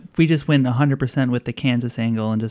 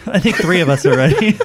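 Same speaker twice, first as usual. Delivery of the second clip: laughing; 8 semitones higher, 5 dB louder than usual. Real, not acted. Laughs while joking.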